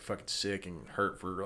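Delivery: normal talking voice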